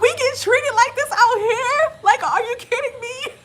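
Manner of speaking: high-pitched, hammed-up astonishment; amazed disbelief. Guest stunned by royal treatment abroad, incredulous. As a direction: squeal it, unable to believe your luck.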